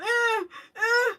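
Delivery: put-on high whimpering cries like a stuck toddler; baby's scared whimper. Mom mimicking her scared baby's high whimper. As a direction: imitate the baby crying out. Mother imitates crying stuck baby.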